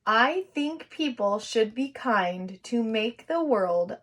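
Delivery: slow, clear sentence reading; slow dictation. Shown as a demonstration. Teacher to kids, slow dictation. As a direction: read it out slowly for writers. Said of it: "here's how it goes".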